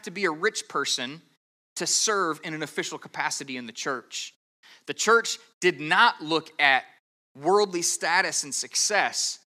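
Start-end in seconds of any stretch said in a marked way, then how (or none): none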